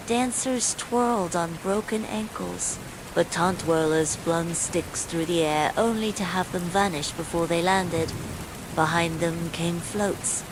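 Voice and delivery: usual speaking voice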